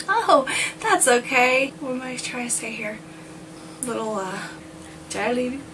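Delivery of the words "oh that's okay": laughing through words. Real, not acted.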